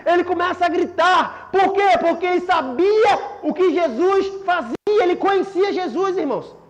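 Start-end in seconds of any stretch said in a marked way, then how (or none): none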